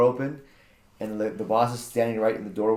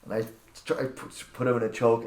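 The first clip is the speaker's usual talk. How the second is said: ordinary delivery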